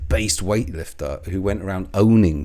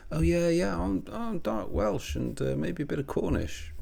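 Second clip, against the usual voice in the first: mock evasive reply; 9 semitones higher, 6 dB quieter than usual. Imitating Cornishdale dodging the ancestry question, higher. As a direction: play him squirming out of the question.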